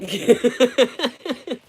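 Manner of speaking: high, loud burst of laughter; can't hold back the laughter; loud real laugh. Real, not acted. Cracks up at the comment.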